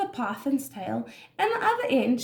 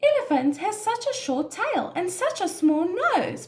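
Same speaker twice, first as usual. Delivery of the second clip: higher-pitched, lightly teasing character voice; mild giggly Python; 7 semitones higher than usual. A narrator's reading. Python giggles at Elephant's short tail, mildly amused. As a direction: lightly act a giggling python, without real laughter. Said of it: "Python giggled".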